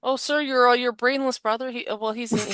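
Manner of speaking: high, hesitant imitation of her reply; mock helpful woman.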